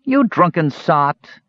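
high, slow, drawn-out taunt; jeering little kender. A narrator's reading. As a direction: taunt him in a high-pitched voice, stretching each word. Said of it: "the Kender taunted".

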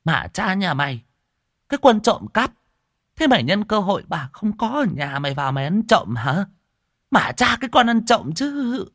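put-on high, loud old woman's shouting; furious scolding. A narrator's reading. Old woman screams at the rice thief, furious and loud. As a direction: yell at the thief who ate her rice. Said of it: "Bà quát".

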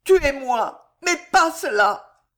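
loud, high, desperate plea; anguished cry. A narrator's reading. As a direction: cry out as if torn apart. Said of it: "dans un cri déchirant".

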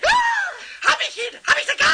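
loud, high panic; panicked cry. Acted. Pumuckl panics to Eder about having a hangover, high and loud. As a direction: shriek it in panic.

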